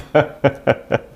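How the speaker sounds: rire aigu et saccadé; rire amusé. Real, not acted. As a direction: pouffe de ta propre gaffe. Rit de sa propre bourde. (rire spontané)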